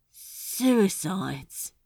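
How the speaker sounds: said flatly, no lift at all; flat and stunned, letting the word drop. A narrator's reading. Crowley, stunned by Asmodeus's trick, says it flatly. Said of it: "he said flatly".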